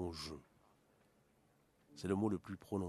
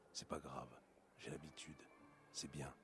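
resigned sadness; 3 semitones lower, 9 dB quieter than usual. Acted. Lonely man resigning himself.

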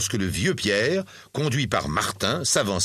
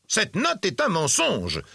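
slow, high-pitched accusation; outraged cry; 9 semitones higher, slower than usual. A narrator's reading. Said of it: "s'écria le mari de Bertrande". (cri d'accusation indigné, aigu et lent)

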